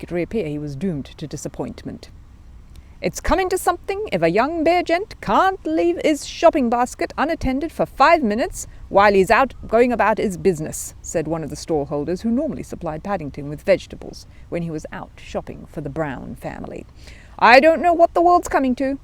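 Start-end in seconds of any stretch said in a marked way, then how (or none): 3.0-10.9 a higher, louder market trader; indignant stallholder. A narrator's reading. Stallholder voiced in story. 17.4-19.0 much higher and louder exasperated voice; exasperated trader. A narrator's reading. Stallholder voiced in story.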